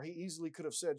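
normal talking voice

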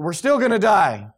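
high, loud cry of doom; hammed-up fearful outcry; mock panic. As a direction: wail it like a panicked crowd. Acts out Israelites chased by army.

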